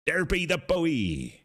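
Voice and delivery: a pirate spotting land, thrilled; excited pirate cry. Pretend. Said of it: "the pirate thing".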